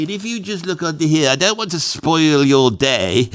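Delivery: lightly voiced plummy posh English expert; a hint of a posh English antiques expert, briskly letting him down. Posh English antiques expert telling the owner his piece is fake, brisk and plummy. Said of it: "chatting to this posh bloke".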